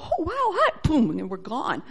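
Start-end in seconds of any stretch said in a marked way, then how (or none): 0.0-0.7 high, light awestruck squeak; put-on wide-eyed wonder, softly squeaked; mock startled greeting. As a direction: imitate being caught by surprise. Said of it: "We'd probably go".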